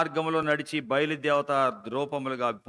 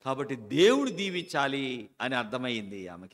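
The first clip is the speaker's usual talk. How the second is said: ordinary talk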